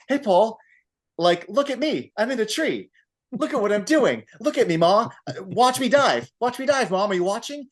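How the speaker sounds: put-on high, eager kid calling for attention; childish showing off. Podcaster voices a grown man acting like a kid calling to his mom, high and eager.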